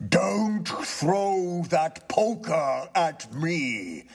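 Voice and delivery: high, slow, lofty spirit; a solemn ghost warns him off; ghost's voice. Acted. Ghost warns the tenant about the poker, slow and eerie.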